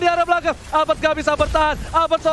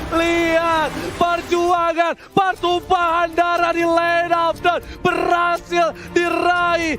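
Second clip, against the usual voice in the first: slow dramatic call; much slower than usual. Real, not acted. Slow dramatic victory recap.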